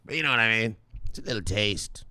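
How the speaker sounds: ordinary talk, nothing marked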